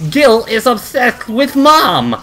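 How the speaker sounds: high, slow cartoon twin; hammed-up tattling little sister; silly voice. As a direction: draw it out in a silly high-pitched voice. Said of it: "I need a silly voice".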